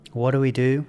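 usual speaking voice

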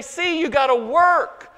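high, loud "work" in an imitated insistence; "work" punched as the proof word; mock stress on WORK. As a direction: lean hard on "work", mimicking a misreader. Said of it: "putting the emphasis on the wrong syllable".

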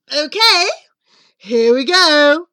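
wolf revving up for action; eager wolf. Acted. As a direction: announce it gamely and loudly. Wolf readies himself to blow.

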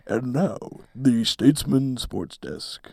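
announcer voice. Acted. Mock show intro after a pause.

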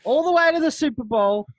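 rowdy Cowboys cheer to his mate; fan hype. Acted. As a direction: belt it like a rowdy fan chant. Hyping Cowboys to the Super Bowl.

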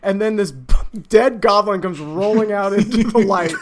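ordinary talk, nothing marked

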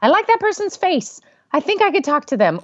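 imagined inner voice. Retold or imitated.

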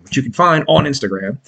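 ordinary talk

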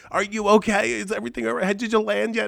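high-pitched fretting mother impression; mock worried mom voice; imitating mom. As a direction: rattle off anxious mom questions, high. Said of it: "multiple texts from my mom".

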